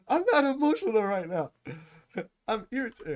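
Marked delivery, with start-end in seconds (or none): 0.1-1.5 very high, hammed-up protest; over-the-top emotional denial; mock outburst. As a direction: deny it in a squealed mock panic. Acting out heated text denial.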